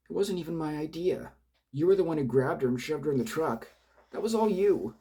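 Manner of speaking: very high, loud desperate voice; panicked protest. Acted. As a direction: shriek the denial in panic.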